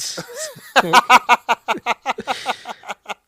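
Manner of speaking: a loud, high burst of laughter; big high laugh. Real, not acted. Host recalls teenage searches, loud high laughter. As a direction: crack up remembering being thirteen.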